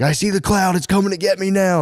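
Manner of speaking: high mock child's voice; scared boy. Boy fearing the clouds, high mock child voice. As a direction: whine it like a frightened little boy. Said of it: "he was like".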